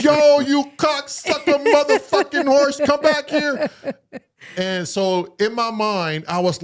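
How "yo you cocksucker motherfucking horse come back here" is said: high, louder mock yell at a runaway horse; a retold holler after the horse. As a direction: call the bolting horse back, half-joking. Calling after the fleeing horse.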